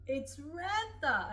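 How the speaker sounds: high, loud, slow crying out of a name; a mock sob of recognition, stretched out; tearful cry. Under hypnosis recognising her husband, tearful high cry.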